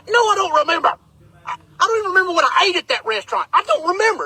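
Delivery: high-pitched, defensive protest; exasperated denial. Retold or imitated. Acting his exasperated denial.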